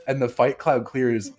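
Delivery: ordinary talk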